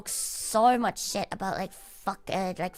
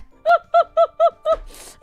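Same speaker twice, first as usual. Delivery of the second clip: quick, high burst of laughter; 8 dB louder than usual. Real, not acted. Laughing at himself after ranting to the listener. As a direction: break into laughter after the rant.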